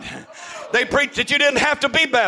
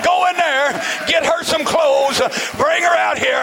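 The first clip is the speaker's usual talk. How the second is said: urgent shout; 6 semitones higher than usual. Retold or imitated. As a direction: shout the order across the yard. Urgently telling wife to fetch clothes.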